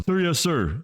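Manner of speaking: high, crisp military reply; snapping to attention. A narrator's reading. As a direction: answer like a soldier snapping to attention. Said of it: "snapping to attention".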